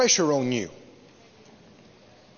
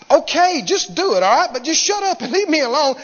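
louder, higher burst of giving in; exasperated; 6 semitones higher, 4 dB louder than usual. Acted. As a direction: act fed up with being nagged. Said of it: "you're so exasperated until you just go".

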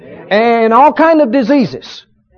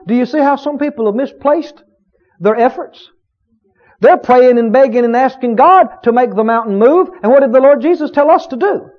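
usual speaking voice

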